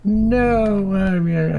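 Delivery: attempted exasperation, pitched high; drawn-out, high acted protest; half-hearted go at loud exasperation; exasperated. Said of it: "loud, exasperated".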